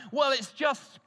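high, loud, slowed-down protest; indignant shout. Retold or imitated. Pupil shouting at his teacher in class, loud indignant outburst. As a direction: blurt it out like an outraged teenager. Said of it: "shouted out".

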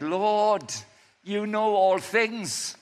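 slow pleading, rising to a high loud cry. Acted. Peter pleading with Jesus, rising to a cry. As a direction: beg him, building to a cry. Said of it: "It's like a plea".

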